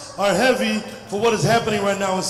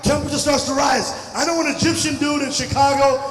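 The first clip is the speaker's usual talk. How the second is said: ordinary talk, nothing marked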